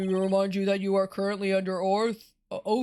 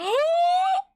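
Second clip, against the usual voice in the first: sharp, very high gasp; shocked gasp; 6 dB louder than usual. Acted. Shocked by disguise reveal.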